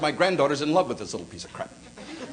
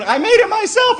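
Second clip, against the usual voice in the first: put-on high, bright little-girl voice, beaming; beaming granddaughter; 5 dB louder than usual. Granddad voicing his proud granddaughter, high and beaming. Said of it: "she's just beaming".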